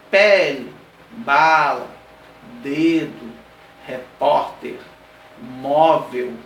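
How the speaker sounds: pele, bala, dedo, repórter, móvel, one by one, strong then falling away; penultimate stress. Shown as a demonstration. Teacher giving paroxytone examples, penultimate stress on pele, bala, dedo, repórter, móvel. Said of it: "o posicionamento na penúltima".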